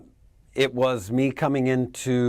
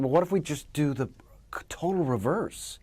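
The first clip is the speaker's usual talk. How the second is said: retold whisper; 3 semitones higher, 4 dB quieter than usual. Retelling his idea in studio.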